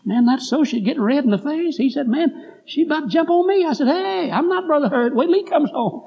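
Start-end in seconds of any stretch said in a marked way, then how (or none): 2.1-3.7 very high-pitched retold alarm; rattled associate. Associate telling preacher about angry daughter, acted agitation. 3.9-6.0 very high-pitched mock protest; flustered protest. Associate deflecting angry daughter onto absent preacher, acted fluster.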